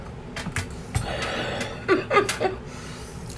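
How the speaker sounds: high giggle. Real, not acted. Laughing at bland meal review.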